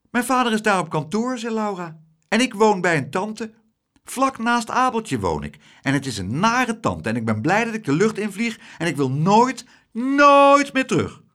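put-on a girl who never wants to go back; Laura eagerly telling the adults about her aunt; young girl Laura.

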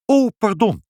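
high startled stammer. A narrator's reading. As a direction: blurt it out caught in the act. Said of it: "hakkelde meneer Pinkepank".

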